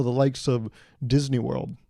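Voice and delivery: normal talking voice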